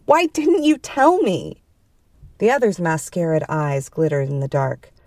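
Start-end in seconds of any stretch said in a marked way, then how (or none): 0.0-1.6 high, loud, drawn-out accusation; loud demand. A narrator's reading. Woman confronting the other over missed calls, loud demand. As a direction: demand an answer, pitch leaping. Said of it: "the woman said loudly".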